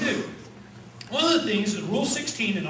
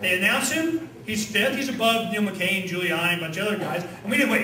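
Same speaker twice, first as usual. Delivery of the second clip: ordinary talk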